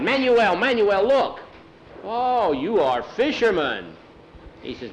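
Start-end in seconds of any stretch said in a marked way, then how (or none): 0.0-1.4 high, loud excited calls; all excited. A narrator's reading. Boys showing Manuel their fish, excited. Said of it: "They were all excited". 2.0-3.9 slow, high, drawn-out admiration; admiring exclamation. Acted. Manuel seeing the boys' fish, impressed.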